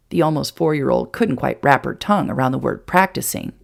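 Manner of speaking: plain reading voice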